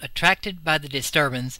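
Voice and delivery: ordinary delivery, nothing marked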